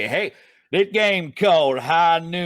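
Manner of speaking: slow, drawn-out Western cowboy voice; cowboy drawl. Shown as a demonstration. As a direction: announce it like an old Western cowboy. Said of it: "you want me to put on my cowboy voice".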